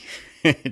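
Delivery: a short, loud, high laugh. Real, not acted. Host laughing about the cold in Spain, loud laugh. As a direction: burst out laughing.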